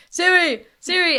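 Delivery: loud Italian grandpa yelling; Italian accent shouting. Retold or imitated. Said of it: "a very thick Italian accent".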